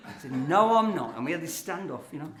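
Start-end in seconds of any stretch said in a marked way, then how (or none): 0.3-1.2 louder, laughing through the denial. Retold or imitated. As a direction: insist again, cracking up. Standoff escalates, laughing retelling.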